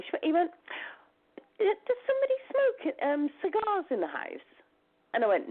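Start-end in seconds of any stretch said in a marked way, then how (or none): 1.6-4.5 the psychic's question, voiced well up high, then settling back. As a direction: lift the voice up as the visiting psychic, puzzled. Retelling the psychic's words.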